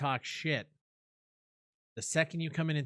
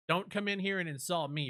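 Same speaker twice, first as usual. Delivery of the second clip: usual speaking voice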